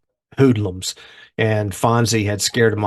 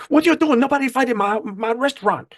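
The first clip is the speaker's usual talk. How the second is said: Arnold scolding; 13 semitones higher than usual. Retold or imitated. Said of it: "he's like".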